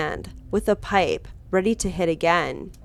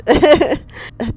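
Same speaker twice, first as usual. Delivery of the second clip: high, breathless laugh; nervous laugh; 8 semitones higher, 7 dB louder than usual. Real, not acted. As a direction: laugh off the tension. Laughs after shouting at herself.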